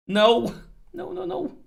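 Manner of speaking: loud, shrill cry of dismay, then falling; frustrated shout. Real, not acted. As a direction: yell "no" at the screen in real frustration. Siege fails at 49 percent.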